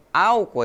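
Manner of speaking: álcool with AL punched, Portuguese-style; álcool stressed on AL, light ending; stress on first syllable. Shown as a demonstration. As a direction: hit the start of álcool: AL-co. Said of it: "o tônico pra nós é o al".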